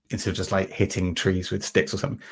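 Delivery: ordinary delivery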